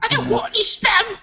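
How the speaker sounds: high pitched mock outburst, spam sketch style; mock shrill complaint. Quoting the spam sketch to the podcast crew, high mock outrage. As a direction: whine it up high like a fussy diner.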